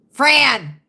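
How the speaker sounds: loud, sudden call. Real, not acted. Interrupting herself to call to Fran, calling out. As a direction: call the name out loudly.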